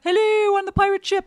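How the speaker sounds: high, loud answering call from afar; calling back. Retold or imitated. The haunted forest crew calling back to the pirate ship, loud high call. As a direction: shout back across the water. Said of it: "and they go".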